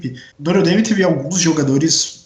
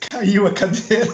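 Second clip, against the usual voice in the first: laughing; 6 semitones higher than usual. Real, not acted. Just fell off chair.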